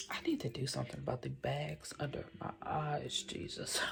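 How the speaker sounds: hushed, almost whispered aside; under-the-breath grumble; muttered aside. Real, not acted. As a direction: mumble it quietly to yourself. Muttered aside about her own looks.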